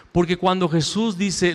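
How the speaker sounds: normal talking voice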